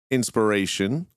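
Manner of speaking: ordinary talk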